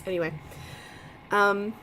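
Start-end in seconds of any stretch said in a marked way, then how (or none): none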